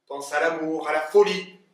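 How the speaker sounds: swelling to a loud climax on folie; building fervour. Acted. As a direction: build from tender thought to outburst.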